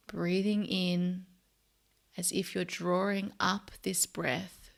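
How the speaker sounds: soft, unhurried meditation voice with long pauses; guided meditation. Real, not acted. Host leading listeners to notice body and breath, soft meditation voice. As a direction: guide them gently into body and breath, quietly.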